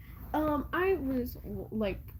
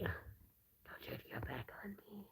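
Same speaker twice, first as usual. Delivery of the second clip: whispered plea to a pet; hushed mock plea to the hamster; 3 semitones lower, 18 dB quieter, slower than usual. Real, not acted. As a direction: breathe it out, unvoiced and slow. Repeats it to the hamster.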